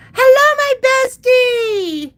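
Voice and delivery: very high, loud, stretched-out hello; squealed greeting. Real, not acted. Streamer greeting her best friend online, gleeful squeal. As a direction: sing it out long and high.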